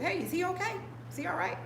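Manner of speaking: high-pitched voice of a concerned passenger; concerned lady. Retold or imitated. Voicing a lady in the van.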